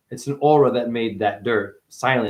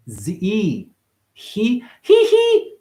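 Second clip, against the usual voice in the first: rising syllables; 7 semitones higher, much slower than usual. Shown as a demonstration. Playing with sounds, He pitched higher each time. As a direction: climb up on each He.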